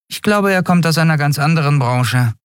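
high-pitched little character voice; hedging Nelen. A narrator's reading. Small crewmate hinting at the customer's trade, high and hedging. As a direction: offer the guess in a high voice.

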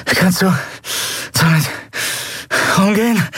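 panting, high and gasping; out of breath. A narrator's reading. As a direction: gasp it out between breaths. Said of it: "keuchte Lien nach Atem ringend".

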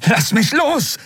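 high, agitated cry; struggling protest. Acted. Kai caught by the collar, high struggling protest. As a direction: cry out to be released.

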